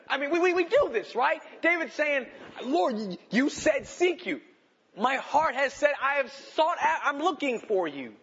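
high, loud, impassioned preaching; preaching at full force. Acted. Pastor preaching David's plea to the church, loud and fervent. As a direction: thunder the psalm out to the congregation.

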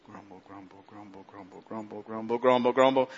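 rising crescendo of muttering. Shown as a demonstration. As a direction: build a grumble from barely audible to full voice. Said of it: "it started low".

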